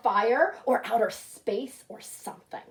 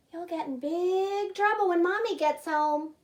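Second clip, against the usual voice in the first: put-on high, knowing little-girl warning; sing-song threat of trouble; little sister voice; 6 semitones higher than usual. Little sister warning her brother, high little-girl voice.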